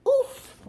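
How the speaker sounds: high grunt of being hit; oof of impact. A narrator's reading. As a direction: react to a snowball hit. Hit by snowball.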